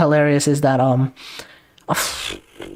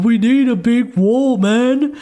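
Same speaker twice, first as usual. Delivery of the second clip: higher, slow, swaggering mock Trump; Donald Trump impression; 7 semitones higher, slower than usual. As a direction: draw it out like a boastful politician. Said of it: "I'm surprised Donald Trump never said".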